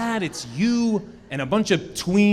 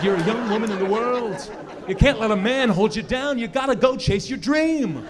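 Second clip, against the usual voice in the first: mock rousing pep talk; 7 semitones higher than usual. Supportive boyfriend, rising excitement.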